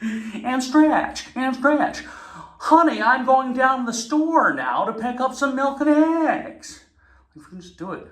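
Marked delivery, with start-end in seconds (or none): 0.0-2.0 high, stretched-out fitness cue; overenergetic instructor. Retold or imitated. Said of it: "a hundred percent energy at all times". 2.6-6.8 high slow gushing instructor voice on an everyday line; Jane Fonda full energy. Retold or imitated. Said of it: "a hundred percent energy at all times".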